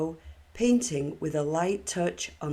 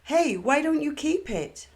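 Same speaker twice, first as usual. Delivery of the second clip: high, louder call down the beach; 10 semitones higher, 4 dB louder than usual. A narrator's reading. Narrator calling out to October on the shore, high raised call. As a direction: shout it across the sand to her. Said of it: "I called out".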